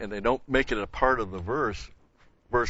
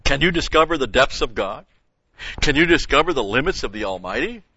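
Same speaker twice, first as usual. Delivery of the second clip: louder reading of the rhetorical question; scripture reading; 3 semitones higher, 6 dB louder than usual. Acted. Preacher reads Zophar's blunt question from Job aloud, louder. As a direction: press the question on the listeners.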